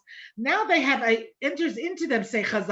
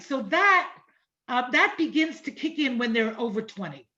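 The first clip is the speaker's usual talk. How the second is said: ordinary talk, nothing marked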